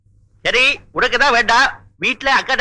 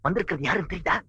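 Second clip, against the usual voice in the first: much softer, secretive; whisper-soft confidential question; hushed low voice; 5 semitones lower, 10 dB quieter, faster than usual. Acted.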